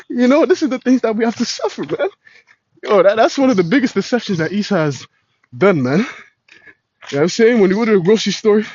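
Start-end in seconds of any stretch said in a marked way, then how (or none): none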